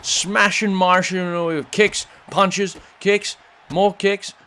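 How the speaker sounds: loud, high, rapid-fire play-by-play; excited commentary. Acted. Commentator yelling as Harley rushes Mai Shiranui, excited. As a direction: erupt as the attack breaks out.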